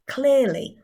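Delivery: "clearly" slow and fully articulated; clear diction. Shown as a demonstration. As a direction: spell out every sound of "clearly". Models clear pronunciation.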